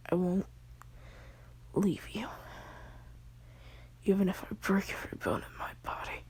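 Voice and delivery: hushed story told in a whisper; whispered storytelling. Acted. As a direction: whisper the whole tale close to the mic. Whispered retelling of anime fight.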